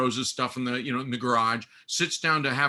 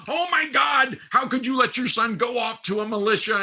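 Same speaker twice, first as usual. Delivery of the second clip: high-pitched mock tantrum shout; hammed-up, scandalised high outburst; ranting and raving; 9 semitones higher, 3 dB louder than usual. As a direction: imitate an outraged aunt going off. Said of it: "she's just ranting and raving".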